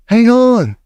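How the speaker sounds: high, loud urgent cry; Mildred halting the room. A narrator's reading. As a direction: stop everyone with a cry. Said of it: "cried the witch called Mildred".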